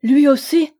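high, loud cry of despair; desperate dramatic outcry. A narrator's reading. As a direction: exclaim it in despair, pitch lifted. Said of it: "s'écria-t-elle avec désespoir".

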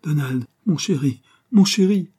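swelling, high-pitched lover's cry; impassioned reply. A narrator's reading. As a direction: answer a lover, rising with each endearment. Lovers embrace, she answers his cry.

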